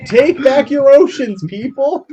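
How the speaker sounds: slow, high, loud crowd-rousing shout; hammed-up soapbox preacher; street preaching. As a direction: holler it slowly at passers-by. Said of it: "like, preaching in the streets".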